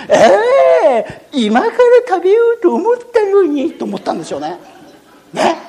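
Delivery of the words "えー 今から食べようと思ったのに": dismayed crocodile. Acted.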